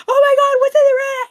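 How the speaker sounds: very high, loud mock terror; panicked screaming. Acting out someone panicking in a dark room, screaming. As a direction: shriek it in hammed-up panic. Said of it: "you don't start screaming".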